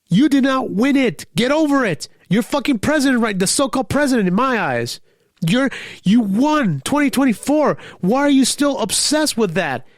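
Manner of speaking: exasperated, telling Trump off; angry rant. Real, not acted. Ranting at Trump about prize.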